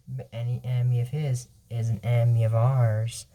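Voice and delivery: low, soft, measured voice; calm villain. Acted. Shade offers alliance to enemy's foe, calm low voice. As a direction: keep it calm, quiet and down low. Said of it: "She talks so calm".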